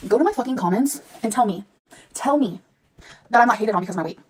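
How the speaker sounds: normal talking voice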